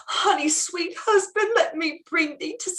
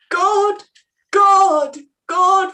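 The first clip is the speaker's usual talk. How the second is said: louder, tearful cries of God; tearful crying out; 3 semitones higher, 6 dB louder, much slower than usual. Acted. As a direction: wail it through tears. Hostess retells the death, weeping.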